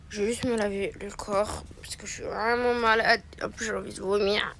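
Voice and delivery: faint whispered sick voice. Pretend. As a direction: breathe the words out like you're ill. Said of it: "faire une voix très malade".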